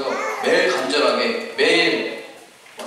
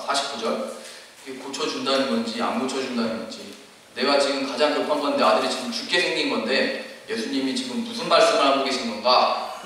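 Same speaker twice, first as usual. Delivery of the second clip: usual speaking voice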